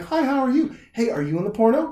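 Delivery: high-pitched chirpy greeting; imitated friendly girl. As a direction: play her approaching with a casual question. Acted girl greeting him afterwards.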